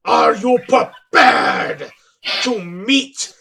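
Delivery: slow, loud, drawn-out proclamation; announcer call. Acted. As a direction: hype the team to the crowd. Announcer introducing team.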